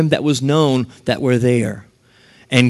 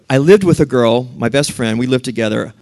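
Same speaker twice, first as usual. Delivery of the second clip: usual speaking voice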